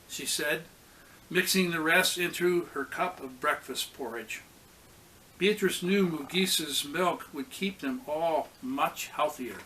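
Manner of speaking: ordinary delivery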